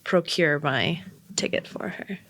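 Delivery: low sultry tone. Acted. Player describing her thigh-strap ticket, low and sultry. Player speaks lower, quieter.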